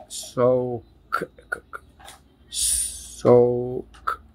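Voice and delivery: سوك stretched very slowly, sound by sound. Shown as a demonstration. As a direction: draw سوك out slowly for counting. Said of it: "زي السوك". (تقطيع سوك ببطء شديد)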